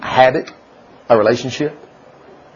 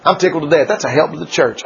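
ordinary talk

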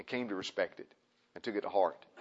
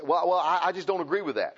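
higher-pitched imitated objector; raised-voice mock objection; mock disagreement; 6 semitones higher, 4 dB louder than usual. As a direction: act out a balking listener, pitched up. Acted listener objecting, then answered.

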